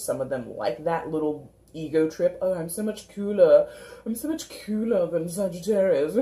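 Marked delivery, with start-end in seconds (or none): 2.4-6.1 hammed-up show-off voice ending in a laugh; mock smugness. Reader mimics friends on an ego trip over Sagittarius, mock-smug voice. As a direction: imitate a smug braggart, slightly raised voice.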